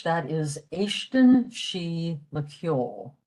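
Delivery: "éiscin sí le ceol" spoken slowly and clearly for learners; careful classroom pronunciation of "éiscin sí le ceol"; slow Irish example. Shown as a demonstration. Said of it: "In Irish".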